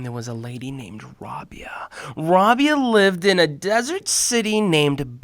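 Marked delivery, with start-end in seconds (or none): 0.0-1.9 storytelling whisper. A narrator's reading. Storyteller hushing the children.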